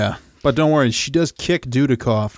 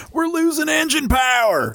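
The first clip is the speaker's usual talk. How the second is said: a mock Southern officer's high call; southern accent; 11 semitones higher than usual. Podcast host imitates the Southern crewman, mock Southern accent. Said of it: "somebody with a southern accent".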